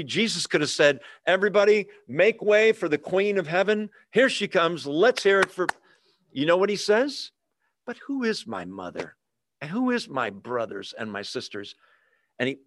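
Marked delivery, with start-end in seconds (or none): 1.3-5.7 hammed-up higher emcee hype; pitched-up mock grand introduction; mock announcer. Imagined Jesus announcing his mother. 7.8-11.7 hushed, weighty reading of scripture; quiet, solemn quote. Retold or imitated. Quoting Jesus' real answer.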